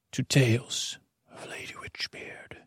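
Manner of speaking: soft whispered title read; whispered show title. Shown as a demonstration. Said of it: "didn't like the way my mouth sounded".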